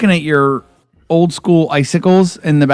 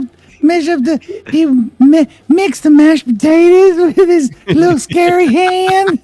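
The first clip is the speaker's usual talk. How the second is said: much higher, wheedling creepy cook; mock creepy voice; 12 semitones higher than usual. Imitating the Scary Movie guy pushing mashed potatoes, higher. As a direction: coax like a creepy cook offering food. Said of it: "he was more like this".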